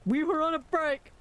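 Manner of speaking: high, mock-anguished we were on a break; dramatic sitcom quote. Streamer quoting the sitcom line in mock anguish. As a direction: ham up the famous line.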